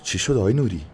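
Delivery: a lightly voiced companion asking; puzzled companion. A narrator's reading. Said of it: "مرد همراهش پرسید".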